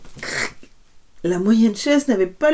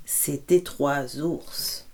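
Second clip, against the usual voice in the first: low, slow dramatic reveal; slow, low story reveal; 5 semitones lower, 7 dB quieter, much slower than usual. A narrator's reading. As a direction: draw it out slowly for suspense. Reveal of the three bears.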